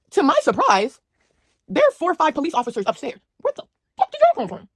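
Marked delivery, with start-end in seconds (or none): 3.4-4.7 high-pitched stunned disbelief; shocked outburst. Retold or imitated. Storyteller reliving cops in hallway, stunned high outburst. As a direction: squeak it out in shock.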